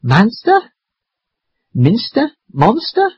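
"Manster", "Minster", "Monster" each rising high as a question. Acted. Grandfather checks on the children, "Manster? Minster? Monster?" in rising questions. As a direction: ask "Manster? Minster? Monster?" with a steep upward lift on each. Grandfather asks after helping them down.

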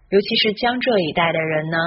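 ordinary talk

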